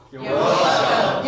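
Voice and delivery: loud, drawn-out crowd-style response; big, slow, shouted courtesy; loud rehearsed reply. Shown as a demonstration. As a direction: belt it out slowly to a legend. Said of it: "That's how you say it".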